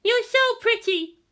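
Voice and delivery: put-on very high, eager child's voice; squeaky wide-eyed child; excited little girl. A narrator's reading. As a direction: ask like an awed kid. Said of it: "grinning wide".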